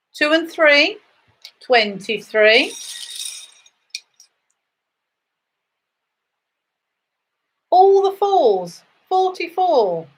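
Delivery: sing-song number calling, rising then falling; bingo caller. Acted. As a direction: call each number out to the hall.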